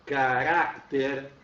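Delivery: "Caráter" said slowly, stress on the RÁ; drawn-out "Caráter", middle syllable hit. Shown as a demonstration. Teacher shows students example word, slow and stressed. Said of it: "Outra lá, ó".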